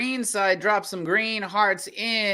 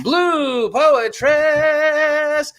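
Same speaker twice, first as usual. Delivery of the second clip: drawn out, loud and higher; big reveal of the winner; winner announcement shout; 8 semitones higher, 7 dB louder, much slower than usual. Real, not acted. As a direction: stretch the name out like a ring announcer. Host announces the round winner.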